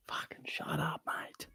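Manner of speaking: hissed whisper at the cat; real annoyed whisper at a noisy cat. Real, not acted. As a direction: whisper it sharply so the mic barely catches it.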